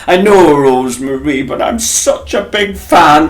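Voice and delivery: put-on a lightly done Hull accent, eager fan; Hull accent retry. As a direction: push the Hull accent harder this time. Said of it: "I can't do the Hull accent".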